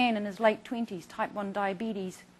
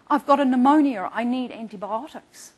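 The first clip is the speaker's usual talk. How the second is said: insistent patient; 3 semitones higher, 12 dB louder than usual. Retold or imitated. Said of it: "he said".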